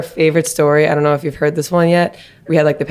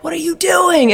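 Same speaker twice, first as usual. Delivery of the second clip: imitated scream; 11 semitones higher, slower than usual. Security guard to women at locker room door, mock scream. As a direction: imitate an outraged scream, pitched way up. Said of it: "he's screaming at us".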